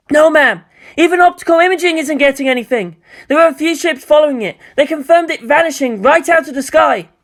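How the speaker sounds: high, fast, loud report; anxious technician. Acted. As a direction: rattle off the bad news.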